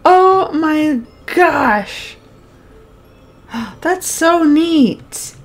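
high, long drawn-out gush; amazed delight. Real, not acted. Gamer wowed by a new spell, amazed. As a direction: marvel out loud, stretching every word.

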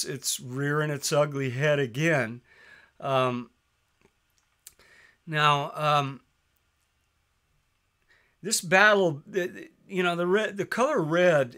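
ordinary talk

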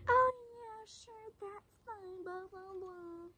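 mock the teacher waving her off; dismissive teacher. French teacher brushing off the upset student, high and dismissive. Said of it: "the French teacher's like".

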